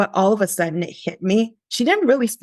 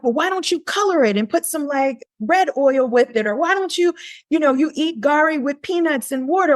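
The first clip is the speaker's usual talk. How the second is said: eager pitch; 7 semitones higher than usual. Retold or imitated. Pitching idea, quoted self.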